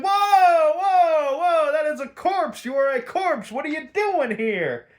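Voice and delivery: very high, loud alarmed yelling; high-pitched cartoonish shock; mock startled panic. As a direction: yelp like a shocked thug, hammed-up. Retold thugs startled by dead man.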